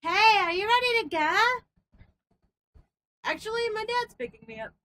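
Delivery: bright put-on friend voice; high girly voice. Schoolgirl chatting at the lockers, high voice.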